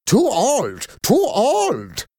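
put-on high, foreign-accented impatience; impatient. A narrator's reading. Foreign caller rejecting the children, impatient. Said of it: "the caller said impatiently".

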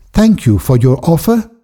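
put-on a girl turning down a rich offer kindly but firmly. A narrator's reading. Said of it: "she said firmly".

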